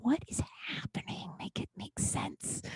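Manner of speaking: whispered disbelief; baffled whisper. Retold or imitated. Bartender baffled by a customer's turnaround, whispering. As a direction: whisper it in bafflement, slowing at the end. Baffled aside after the argument.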